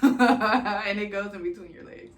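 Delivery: loud laughing through the words. Real, not acted. New mom explaining the peanut ball, laughing loudly. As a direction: burst out laughing as you explain.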